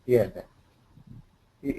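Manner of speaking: ordinary talk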